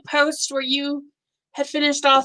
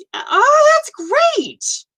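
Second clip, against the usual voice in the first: delighted squeal; 5 dB louder, much slower than usual. Real, not acted. Delighted by a converted picture.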